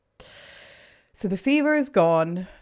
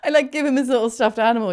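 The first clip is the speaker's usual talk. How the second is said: ordinary talk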